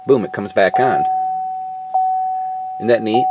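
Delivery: ordinary talk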